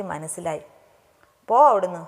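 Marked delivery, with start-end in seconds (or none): 1.5-2.0 loud, sulky brush-off; mock-offended "go away"; huffy retort. A narrator's reading. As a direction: turn away from the teasing, loudly. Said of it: "എന്നും പറഞ്ഞ് ആര്യ തിരിഞ്ഞിരുന്നു".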